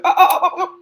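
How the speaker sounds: high, loud string of ohs; mock startled oh-oh-oh. Retelling how he reacted to the video, mock startled ohs. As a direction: imitate his alarmed, repeated oh. Imitating him seeing girl crying.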